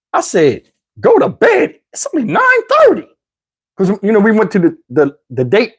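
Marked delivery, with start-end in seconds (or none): 1.0-3.1 very high-pitched mock disbelief; incredulous retort. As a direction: squeak out an indignant protest. Incredulous retort to being sent off.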